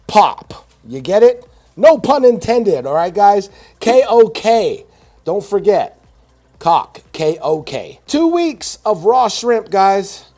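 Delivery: high, loud punchline delivery; excited joking. Real, not acted. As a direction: sell the pun big. Joking hype about pun.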